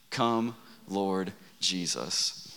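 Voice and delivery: very slow, drawn out, soft; slow prayerful lead. Shown as a demonstration. Pastor leads the crowd in saying it together, slowly. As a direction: lead the room gently word by word. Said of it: "Would you just say that with me?".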